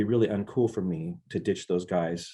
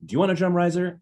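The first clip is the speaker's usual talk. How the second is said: higher, louder, pointed question; direct question; 10 semitones higher, 4 dB louder than usual. Retold or imitated. Les asking the young drummer directly, pointed and higher. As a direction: cut through it and ask the kid straight out. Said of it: "he points at me and he goes".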